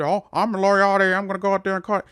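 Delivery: loud, high mocking wrestler voice; mocking Moriarty voice. Podcast host mocks a wrestler's promo, loud and high. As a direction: ham up a cocky wrestler's promo. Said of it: "I want you to never do that voice again".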